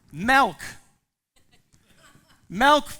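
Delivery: put-on high, loud whiny child calling milk; demanding toddler. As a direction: whine it like a small child wanting milk. Four-year-old demanding milk at table.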